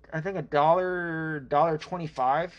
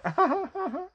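high laugh. Real, not acted. Laughs at his own joke.

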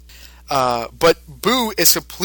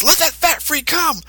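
much higher, swaggering thug voice; cocky thug; 12 semitones higher than usual. Retold or imitated. Gunman brushing off his partner's fear, in a much higher cocky voice. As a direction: brag like a gun-happy goon. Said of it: "And he says".

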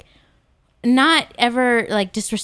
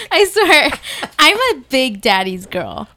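louder, higher gushing confession; 3 semitones higher, 5 dB louder than usual. Real, not acted. Listener admitting she is a daddy's girl, gushing. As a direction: gush it out, half laughing. Gushing about her dad.

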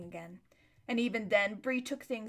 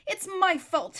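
high, loud self-reproach; furious; 9 semitones higher, 6 dB louder than usual. A narrator's reading. Aravis furiously blames herself on waking. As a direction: snap at yourself in anger. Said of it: "she said to herself furiously".

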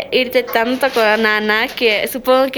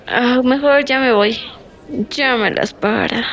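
ordinary talk

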